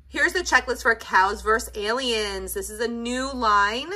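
normal talking voice

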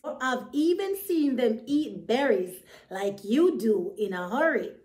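usual speaking voice

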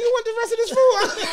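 an eager mother pushing leftovers on you; mom offering food. Retold or imitated. Mom offering her plate.

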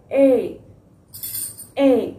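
a drawn-out ए as the action sound; phonics sound ai. Shown as a demonstration. Teacher repeating the ए sound for the class. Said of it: "जोलीफोनिक में उसका action भी यही है".

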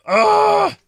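loud, high, amazed outburst; real excited surprise; stunned excitement. Real, not acted. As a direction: blown away by what he hears. Stunned by the band's opening.

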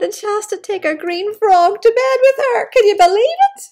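high, loud disbelief; rising outrage on her behalf; incredulous. Real, not acted. Reader amazed the princess must share her bed, loud and high.

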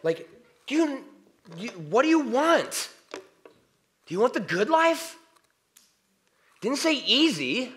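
high, loud nagging inner voice; inner critic voice. Retold or imitated. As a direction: needle yourself like a pushy inner critic. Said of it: "That's my inner critic".

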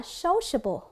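"sociable" begun SOH, O as in go; careful "sociable" with a long first O; long O sound. Shown as a demonstration. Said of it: "câu D là âm O".

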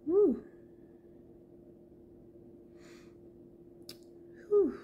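long blown-out breath; shaky exhale. Real, not acted. Steadying herself after nearly crying on camera. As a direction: blow out the tears with a whew. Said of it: "let me breathe".

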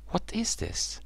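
amazed at his reflection; surprised. Acted. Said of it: "he said".

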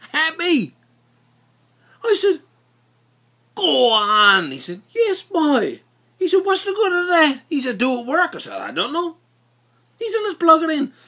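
high, loud, gleeful back-and-forth; excited high voices. Retold or imitated. Friends excited to try the sandblasting box, high and gleeful. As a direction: squeal with excitement.

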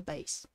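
"bass" with the long a of "ace". Shown as a demonstration. Streamer offering the other reading, "bass" with long a.

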